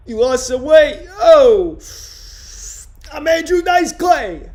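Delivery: high, loud hammed-up pickup-artist voice; Andrew Nice Clay impression. Comic redoing his Andrew Nice Clay impression for viewers, hammed up. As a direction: lay on the sleazy flattery, big and loud.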